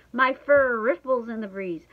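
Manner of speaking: ordinary delivery, nothing marked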